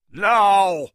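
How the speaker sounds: loud, high one-word command; shouted order. A narrator's reading. Engelhorn ordering his men, a sharp loud command. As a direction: bark it at the men.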